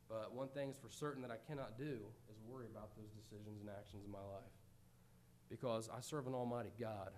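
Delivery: soft, low, trusting prayer; calm prayer. Acted. Believer calmly surrendering worries to God, soft prayer. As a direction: hand it over to God quietly.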